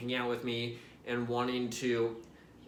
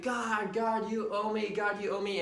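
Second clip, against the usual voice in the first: excited screaming; 7 semitones higher than usual. Retold or imitated. After hitting the crossbar, calling up to God in high excitement. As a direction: shout it up to the sky after scoring. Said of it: "start screaming".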